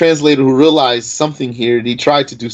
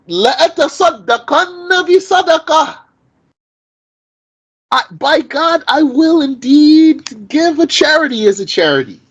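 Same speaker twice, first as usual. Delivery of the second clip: high, raised voice of a man swearing an oath; earnest vow; 14 semitones higher than usual. Retold or imitated. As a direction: swear it solemnly, pitched up high. Man vowing in retold hadith.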